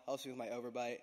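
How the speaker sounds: ordinary talk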